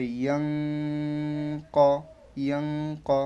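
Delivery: ইয়াং with a long nasal hum before ক; ghunnah. Shown as a demonstration. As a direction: hum the ng of ইয়াং, then land on ক. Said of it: "গুণনা করে করতে হবে". (ইয়াং গুন্নাহ করে টেনে)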